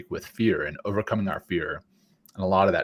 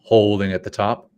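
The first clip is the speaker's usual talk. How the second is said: guided breathing. Acted. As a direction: lead the breaths gently. Leads breathing exercise.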